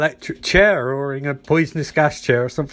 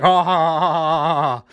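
evil laugh. Acted. Villain laughs over choking Barry.

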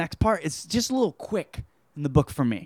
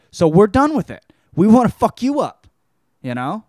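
mock threat; 6 semitones higher, 7 dB louder than usual. Vampire woman threatens Neville.

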